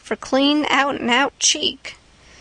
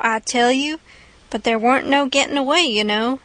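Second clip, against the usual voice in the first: plain reading voice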